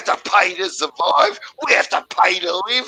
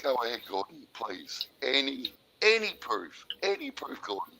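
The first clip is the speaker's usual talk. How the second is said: much softer, lower restrained plea; forced calm; 7 semitones lower, 14 dB quieter than usual. Real, not acted. Caller forcing himself calm while asking Gordon, forced calm. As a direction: hold the anger back, speak softly. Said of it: "I'm not gonna yell".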